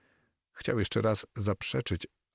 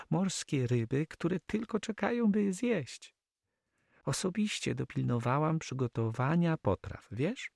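high-pitched female character voice; Flemish girl, eager hostess; 9 semitones higher than usual. Acted. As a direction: show off the dinner in a high lady's voice. (wysoki głos dziewczyny, gospodyni)

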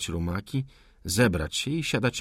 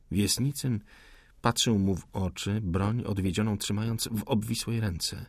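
plain reading voice

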